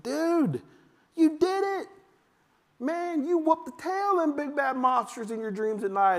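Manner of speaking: high, bright cheer for a son; excited praise. Retold or imitated. Dad cheering son who beat his nightmares, excited high voice. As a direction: cheer him on like he won the big game. Dad celebrating son's victory.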